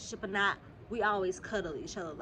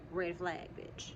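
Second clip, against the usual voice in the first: usual speaking voice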